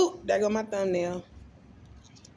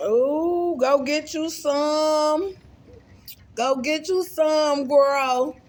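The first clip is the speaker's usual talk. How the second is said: high, loud, drawn-out hype; excited urging; 9 semitones higher, 6 dB louder, much slower than usual. Real, not acted. Vlogger hyping food to viewers, excited and drawn out. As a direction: go get some, stretched out high and loud.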